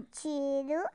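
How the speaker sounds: plain reading voice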